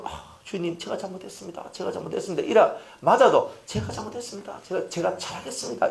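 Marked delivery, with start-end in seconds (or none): 0.0-2.4 hushed servant's plea, pitched up; small, meek apologizing voice; acted humble apology. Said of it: "종은요, 주인이 때리면". 3.7-5.9 soft, higher-pitched pleading; hushed promise to the master; acted humble apology. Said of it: "이렇게 얘기하잖아요".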